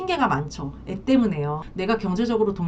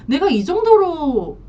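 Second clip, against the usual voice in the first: indignant outburst; 8 semitones higher, 5 dB louder, slower than usual. Retold or imitated. Husband's indignant peak.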